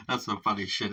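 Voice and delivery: ordinary talk, nothing marked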